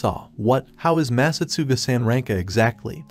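plain reading voice

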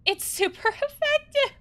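high, loud laugh through the words. Real, not acted. Player laughing at how well the game works.

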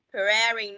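"Preparing" squashed into "prearing"; learner's merged "Preparing", middle p lost; run-together pronunciation. Retold or imitated. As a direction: say "Preparing" with its syllables stuck together. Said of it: "Mặc dù bạn đọc bị dính".

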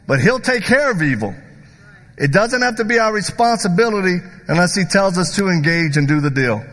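high, loud preaching swell; rising pulpit fervor; full-force preaching. Acted. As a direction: preach it out with conviction.

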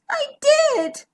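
high, sulky little-cousin voice; sniffy. A narrator's reading. Zara insists to doubting Zigbee, sniffing. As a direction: protest high with a wounded sniff. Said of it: "sniffed Zara".

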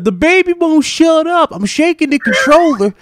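high, loud whining mimicry; mocking whiny gamer. Mocking a Twitter dude's complaint, high whiny voice. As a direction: squeal it like a panicking fanboy. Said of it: "He was like".

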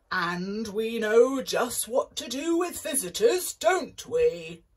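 big booming giant. Acted. Giant speaking.

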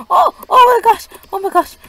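high, loud startled cry; sudden real alarm; shocked gasp. Real, not acted. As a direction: blurt it out in shock.

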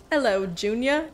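hammy Cockney greeting; British accent. Acted. Greeting raider Junior, hammed-up British accent. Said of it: "they asked me to do a British accent".

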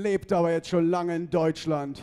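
ordinary talk

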